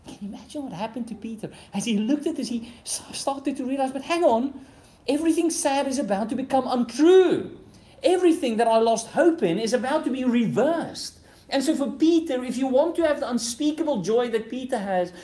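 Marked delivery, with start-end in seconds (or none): 3.9-11.2 high, loud dawning joy; excited realization. Retold or imitated. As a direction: let it burst out as Peter sees it. Peter realizing, voiced excitedly.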